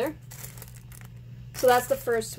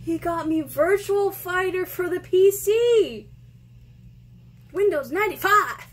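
high, loud, slowed-down delight; thrilled announcing; 7 semitones higher, 5 dB louder than usual. Real, not acted. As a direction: savour each word in disbelief. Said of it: "I'm just so excited".

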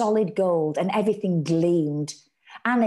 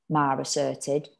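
ordinary delivery

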